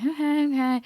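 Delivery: small, high self-soothing voice; trying to stay calm. Retold or imitated. Freaking herself out in labor, trying to stay calm. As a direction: retell talking yourself down, pitched high. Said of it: "trying to, like, stay calm".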